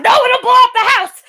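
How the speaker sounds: high, loud mock-panicked shout; alarmed yell. Waver stops Ryder wrecking the house, panicked mock yell. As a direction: yell a frantic objection in imitation.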